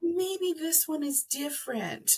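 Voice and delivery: high, slow, soft wishful hope; hopeful self-talk. Retold or imitated. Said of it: "but you're like".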